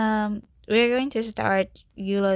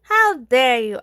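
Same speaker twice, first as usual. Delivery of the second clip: mock indignation; 5 semitones higher, 7 dB louder than usual. Mock offence at game character's suggestion.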